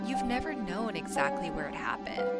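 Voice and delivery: eerie whispered teaser line; whispering. Acted. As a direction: breathe it like a secret.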